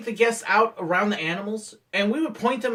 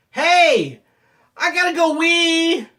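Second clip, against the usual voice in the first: high, loud mock announcement; hammed-up urgent call; 10 semitones higher, 7 dB louder, slower than usual. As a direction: holler it to the manager across the platform. Calling out to manager as prank.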